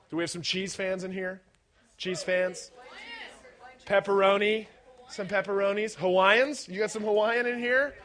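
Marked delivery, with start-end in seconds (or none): none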